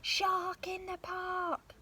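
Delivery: high, slow, loud excited call; boy shouting the alarm to his dad. Acted. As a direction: call it out across the park. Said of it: "and he shouts".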